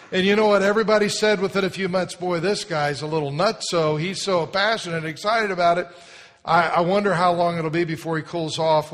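loud, fast, fired-up preaching; full-force preaching. Acted. Pastor tells congregation about Tony, fired-up preaching. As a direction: preach it with fire to the crowd.

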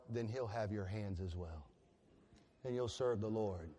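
tender. Real, not acted. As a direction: let it settle gently. Tender close of the illustration.

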